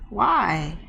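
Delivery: normal talking voice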